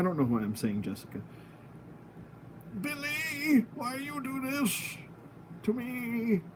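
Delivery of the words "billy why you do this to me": put-on old man, pitched higher and softer; playful higher old man voice for the angry toy head. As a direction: whine it like a wounded old man. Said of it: "I'm doing old man voice right now".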